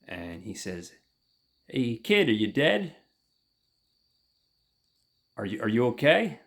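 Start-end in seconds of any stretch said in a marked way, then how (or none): 1.7-6.4 raised, louder checking on someone; retold rousing call. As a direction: call out to wake a child. Retold man rousing sleeping child.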